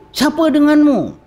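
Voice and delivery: high, sharp challenge from the angel guard; harsh gatekeeper. Retold or imitated. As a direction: bark it like a stern border officer. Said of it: "Amboi garangnya". (penjaga pintu yang garang)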